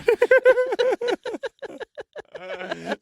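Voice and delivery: long high giggle fit; giggling. Real, not acted. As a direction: burst into high-pitched giggles. Laughing after the remark.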